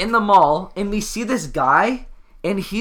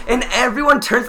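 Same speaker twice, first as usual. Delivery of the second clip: ordinary delivery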